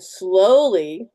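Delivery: very slow, stretched "slowly"; drawn-out slowly. Shown as a demonstration. Showing how to fill the cartridge, drawn out slowly. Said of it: "let me mention that again".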